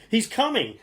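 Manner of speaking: high, loud urgent warning; alarmed warning. A narrator's reading. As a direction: warn him to hide, fast. Giantess warning Jack.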